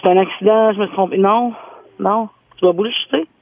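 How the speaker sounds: normal talking voice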